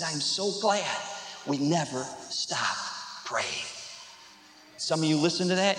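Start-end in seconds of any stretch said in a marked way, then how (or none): 0.0-3.7 low, soft, slowing to a near halt; hushed gratitude. Retold or imitated. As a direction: let the relief sink down quietly. Sister's grateful reply, retold.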